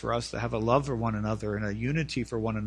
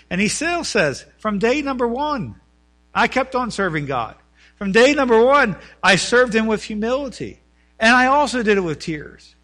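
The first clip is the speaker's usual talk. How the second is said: raised pulpit voice, swelling louder; fervent preaching; 9 semitones higher, 8 dB louder than usual. Acted. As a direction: proclaim it as a testimony. Preaches at full force.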